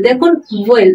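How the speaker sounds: usual speaking voice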